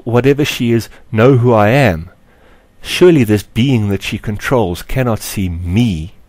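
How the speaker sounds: plain reading voice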